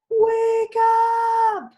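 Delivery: high, loud shout of wake up; calling out. Acted. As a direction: holler it long and high. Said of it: "Hare called out".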